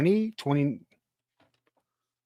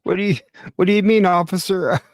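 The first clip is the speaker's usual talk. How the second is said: loud fake innocence to a cop; mock innocent; 4 semitones higher, 8 dB louder, faster than usual. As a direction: play dumb with the officer, loudly.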